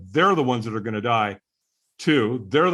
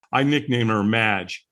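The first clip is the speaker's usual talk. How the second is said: normal talking voice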